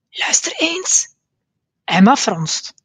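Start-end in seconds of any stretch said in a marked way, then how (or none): 0.1-1.1 hoog, langzaam gefluisterd; fluisterend. A narrator's reading. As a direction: fluister geheimzinnig. Pelle fluistert in donker. (Pelle fluistert tegen de andere kinderen)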